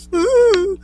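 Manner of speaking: high wordless mock sob; imitated blubbering. Crying kid replying to officers, imitated blubbering. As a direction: blubber wordlessly, exaggeratedly stupid. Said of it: "I sound fucking, like, stupid".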